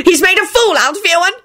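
high, indignant tirade; very high outraged protest; shouted. A narrator's reading. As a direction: shout the accusation until cut off. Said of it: "Percy shouted".

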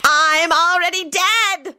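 high, drawn-out villain shout. A narrator's reading. Scully answers Rosie's kill order, shouting high. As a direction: cry it out high and slow. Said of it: "Scully shouted".

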